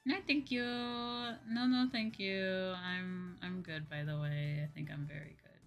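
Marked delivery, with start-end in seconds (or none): none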